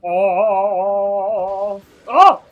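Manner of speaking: sharp high loud gasps of surprise; startled cry. Real, not acted. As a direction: jump at the shock. Surprised reaction in fight.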